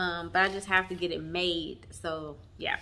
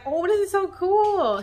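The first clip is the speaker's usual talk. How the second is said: much higher, slow, delighted quote; excited gasp; 11 semitones higher, 6 dB louder, slower than usual. Retold or imitated. Opening a gift and squealing it's so cool. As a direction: relive the thrill of opening it. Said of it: "I was like".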